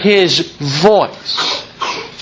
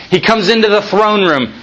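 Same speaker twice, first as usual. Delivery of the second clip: ordinary delivery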